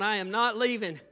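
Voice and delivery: retold stubborn declaration; standing his ground. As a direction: refuse forcefully, voice pushed up. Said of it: "I stood my ground".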